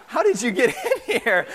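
laughing disbelief. Retold or imitated. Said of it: "We're like".

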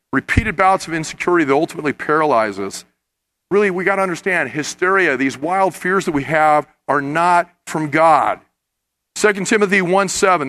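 ordinary talk, nothing marked

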